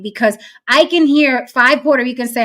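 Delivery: usual speaking voice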